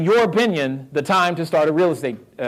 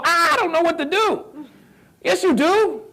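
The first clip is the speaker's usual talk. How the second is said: mock panic; 13 semitones higher than usual. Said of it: "and we go".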